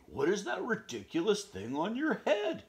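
high, loud laughing pony; mocking pony laughing. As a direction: snort out the question, laughing. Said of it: "the pony snorted".

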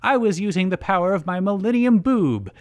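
cackling villainess bragging; gloating villain. A narrator's reading. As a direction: gloat theatrically over the beaten rival. Villain gloats after laughing.

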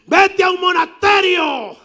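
high, loud, slow mock shout; old passenger yells at the preacher; old man shouting. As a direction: heckle him from the seat. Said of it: "grita un señor mayor".

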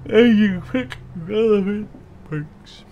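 high, slow, stretched words swallowed by a yawn; talking through yawn. Real, not acted. Gamer reading perk tips, overtaken by a yawn. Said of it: "Oh, I got the yawns".

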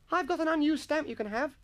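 put-on very high boy voice, volunteering; eager schoolboy. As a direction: pipe up keenly to chip in.

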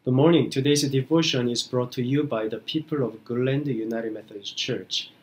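ordinary talk, nothing marked

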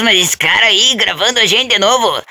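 normal talking voice

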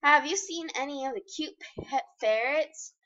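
usual speaking voice

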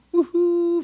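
retold joyful whoop; excited whoop. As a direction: cheer like you just got the keys. Retelling getting truck keys.

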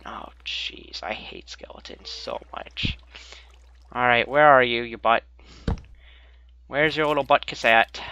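normal talking voice

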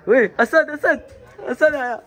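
high, loud eager call; calling Asad as he runs over; excited calling. Real, not acted. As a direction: shout his name with glee.

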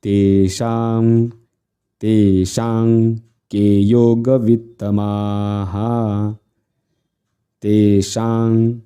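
तेषां with शा held long into the nasal. Shown as a demonstration. Teacher demonstrates long vowels, drawing out तेषां. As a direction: chant slowly, lengthening षां in तेषां. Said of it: "दीर्घ म्हणायचं शा".

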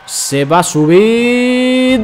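rising hype on the big move; wrestling commentator. Real, not acted. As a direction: call the move like a ring announcer. Calling a wrestling move live. (narrador de lucha emocionado)

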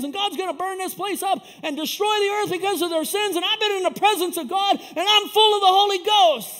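high, loud preacher's tirade, building to a shout; fire-and-brimstone preaching. Retold or imitated. Preaches at his passenger in retelling.

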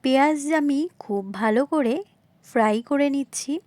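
ordinary talk

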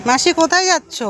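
high-pitched friendly hail; calling out. Real, not acted. Vlogger asks aunt where she is going, calling out. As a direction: call across to a passer-by.

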